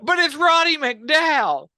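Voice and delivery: loud, high, slowed burst of delight; excited outburst. Real, not acted. Gushing to his cohost about Roddy McDowall, excited loud outburst. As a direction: stretch out each word in gleeful disbelief.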